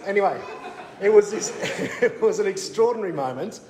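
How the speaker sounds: real laughter breaking in; giggling over the memory; laughing. Real, not acted. Laughing recalling meeting the Pences.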